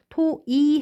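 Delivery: 토이 ending on a plain i. Shown as a demonstration. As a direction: reduce the diphthong to i in 토이. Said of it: "해도 된답니다".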